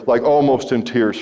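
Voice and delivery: normal talking voice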